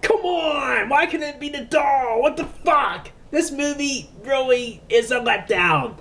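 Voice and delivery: high, whiny disappointed moan; fed-up frustrated viewer; annoyed complaining. Retold or imitated. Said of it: "half of you were like".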